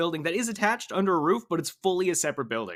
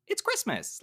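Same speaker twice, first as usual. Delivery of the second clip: upbeat; 8 semitones higher, 5 dB quieter than usual. Retold or imitated. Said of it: "trying to say very upbeat".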